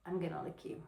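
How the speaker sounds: barely voiced seductive tease; sexy, very quiet. Shown as a demonstration. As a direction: say it very softly, like a secret. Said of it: "so many different sexy ways".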